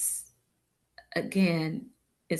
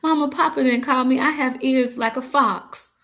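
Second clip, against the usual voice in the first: higher, louder, fast playful teasing; 6 semitones higher, 4 dB louder than usual. Acted. Daughter playfully teases her mother, higher, louder and fast. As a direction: bounce it out cheekily.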